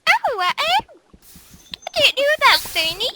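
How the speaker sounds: high, loud mocking whine; mock your friend's silly excuse. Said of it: "You're like".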